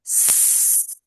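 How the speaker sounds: S hissed like a snake, voiceless; long voiceless hiss on S. Shown as a demonstration. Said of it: "just like the sound of a snake".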